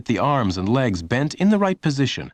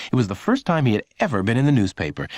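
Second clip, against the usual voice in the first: ordinary delivery, nothing marked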